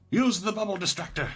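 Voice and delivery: high, emphatic game-line exclamation; hammed-up battle-move callout; acted urgent command. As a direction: call it out like a dramatic move.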